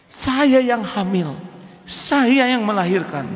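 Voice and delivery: slow high aggrieved wife. Acted. Wife reminding husband of childbirth, slow aggrieved voice. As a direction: drag out each grievance. Wife listing grievances, acted.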